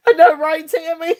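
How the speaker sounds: high, laughing words; real laughter. Real, not acted. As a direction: answer while still giggling. Still laughing with viewers.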